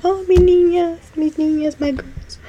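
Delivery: put-on cooing over the little girls. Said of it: "I'm like".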